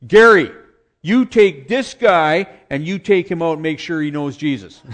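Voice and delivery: shouted name, then a loud, slow command; booming preacher order. Retold or imitated. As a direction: bark it like a preacher at the pulpit.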